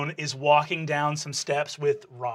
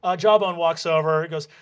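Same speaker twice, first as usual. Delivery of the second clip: normal talking voice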